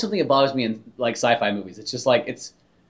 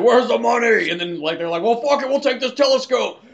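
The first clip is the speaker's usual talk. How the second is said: higher, mock-gruff robber voice; Russian thug; 6 semitones higher than usual. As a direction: ham up a greedy burglar grabbing loot. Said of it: "Russian guys busted in and just said".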